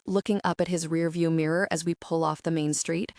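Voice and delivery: plain reading voice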